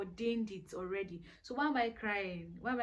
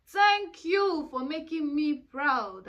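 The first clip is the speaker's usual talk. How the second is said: proud self-thanks; 5 semitones higher, 9 dB louder, slower than usual. Pretend. Quoting her own self-praise.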